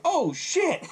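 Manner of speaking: high, drawn-out exclamation of shock; retold amazed reaction. Viewer sees victim ripped in half, hammed-up shock. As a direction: play the stunned viewer, pitch shooting up. Recalled reaction to gory kill.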